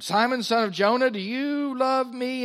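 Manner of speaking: high, loud, stretched-out question; insistent questioning. Acted. Jesus asks Peter a third time.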